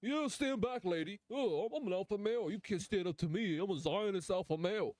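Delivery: mock a swaggering bully; mock tough guy. Mocking a soldier pushing a woman, lightly voiced macho swagger. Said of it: "This dude's like".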